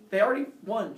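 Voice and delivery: normal talking voice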